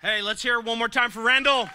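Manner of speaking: loud, high call to the room; rally the crowd for Randall; calling for applause. Real, not acted. Host calling to the whole room, loud and high.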